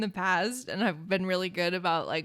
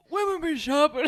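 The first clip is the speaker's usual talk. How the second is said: teasing imitation, lightly raised pitch; mock catchphrase; 5 semitones higher than usual. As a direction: mimic a friend's silly catchphrase. Mimicking friend's catchphrase with laughter.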